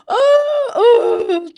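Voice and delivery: hammed-up high, gasping sobs; mock ugly cry. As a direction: pretend to burst into ugly crying. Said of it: "you didn't ugly cry for me".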